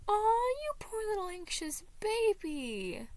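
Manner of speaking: high, slow doting coo; gushing. Acted. Patton fussing over Anxiety, gushing high and slow. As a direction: coo over him like a baby. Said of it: "Patton gushed".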